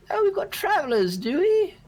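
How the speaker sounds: put-on very high, delighted old-lady voice; older lady. Lady spotting hungry travelers on the road, older lady voice. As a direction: coo over the strangers in falsetto. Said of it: "you see this older lady pop out".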